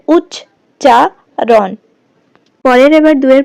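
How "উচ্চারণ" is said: উচ্চারণ drawn out very slowly, syllable by syllable; teacher dividing উচ্চারণ slowly into syllables; slow syllable split. Shown as a demonstration. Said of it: "দল বিশ্লেষণ করলে কি হয়".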